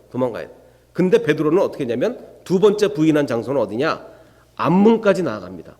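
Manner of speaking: usual speaking voice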